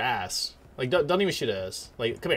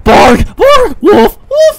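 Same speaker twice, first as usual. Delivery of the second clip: very loud, high dog barks; mock barking; 19 dB louder, much slower than usual. Gamer barking at enemies, full-volume mock barking. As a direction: fire off shouted woofs, one by one.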